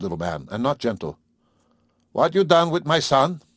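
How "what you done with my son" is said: put-on higher, louder old farmer demanding; accusing farmer. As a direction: challenge her like a hostile father. Father demands son.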